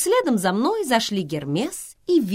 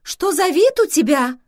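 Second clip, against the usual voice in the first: very high, loud startled questions; astonished; 12 semitones higher, 8 dB louder than usual. A narrator's reading. Vivian sees his torn clothes, asks in astonishment. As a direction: exclaim high in amazement. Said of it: "поразилась Вивиан".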